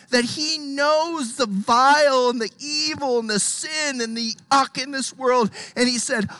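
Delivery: loud, high, impassioned preaching; preaching at full force. Acted. Pastor to his flock, preaching at full force. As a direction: lift the congregation, pressing every line.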